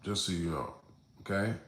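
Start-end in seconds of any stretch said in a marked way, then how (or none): none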